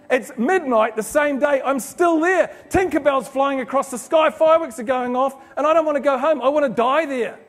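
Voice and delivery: loud, high, gushing joy; rapturous. Real, not acted. Speaker reliving the Disney fireworks night, loud and ecstatic. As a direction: build it up in ecstatic wonder.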